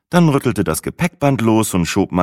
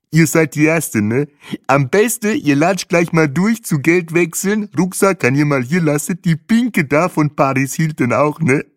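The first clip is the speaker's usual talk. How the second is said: high, loud, exuberant guide voice; überschwänglicher Tourguide; 10 semitones higher, 4 dB louder than usual. Acted. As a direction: greet them loudly, pitched way up, tag question at the end. Tourguide gibt Anweisungen. (fröhlicher Reiseleiter, hoch und laut)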